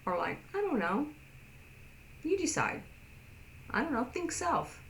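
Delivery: high, light "you decide" shrug; easygoing indifference. Retold or imitated. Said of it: "But a deaf person would say".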